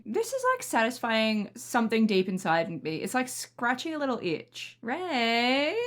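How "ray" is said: ordinary talk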